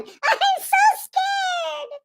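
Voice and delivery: slow, high whimpering mock cry. Stoner hiding in a closet, slow high mock sobbing. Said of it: "you going".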